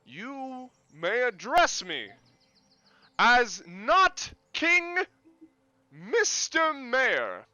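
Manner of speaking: very slow, high, loud proclamation; grand mayor. Acted. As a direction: draw out every word with pomp.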